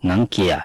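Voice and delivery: "หงังเกีย" said the Chinese way, with Chinese tones; Chinese word. Shown as a demonstration. Teacher giving the Chinese word for glasses, Chinese pronunciation. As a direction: give "หงังเกีย" as a Chinese speaker would. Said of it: "ก็ว่า".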